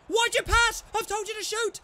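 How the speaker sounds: high, loud frustrated yell at a teammate; angry shout. Real, not acted. Gamer yelling at his striker, angry shout. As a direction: bark it at the striker who won't shoot.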